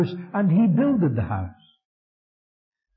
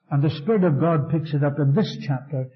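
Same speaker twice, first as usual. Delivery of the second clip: usual speaking voice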